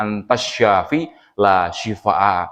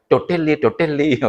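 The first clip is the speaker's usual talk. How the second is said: mock Pakistani speech; 7 semitones higher than usual. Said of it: "ngomongnya pakai tatu tatu".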